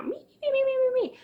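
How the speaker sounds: very high squeaky cartoon chipmunk. Shown as a demonstration. Demoing a nagging boss as a high cartoon chipmunk. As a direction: squeak it like a tiny mouse. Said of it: "little cartoon chipmunk voices".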